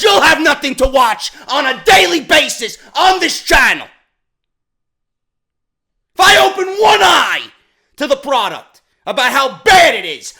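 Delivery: loud, high, furious yelling; angry shouting rant. Real, not acted. Ranting vlogger yelling at fans to open their eyes, furious shout. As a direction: yell it at them, fed up.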